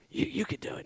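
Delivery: hushed, voiceless nudge; whispered urging. Acted. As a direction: whisper it so the giant won't hear.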